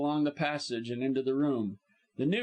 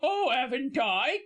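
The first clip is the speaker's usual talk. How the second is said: high-pitched indignant Cockney servant; indignant cry, aitches dropped; 11 semitones higher, 3 dB louder than usual. A narrator's reading. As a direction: retort in high-voiced affront. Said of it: "cried Missus Baskett indignantly".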